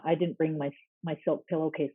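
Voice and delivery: normal talking voice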